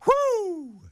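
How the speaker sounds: high drawn-out "woo"; whoop. Real, not acted. Host cheers another eight years, high whoop.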